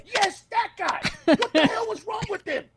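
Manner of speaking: high, laughing gossip voice; playful high mock of a comic; mocking comedian. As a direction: cackle at the weird guy in the crowd. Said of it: "the other comedians will be like".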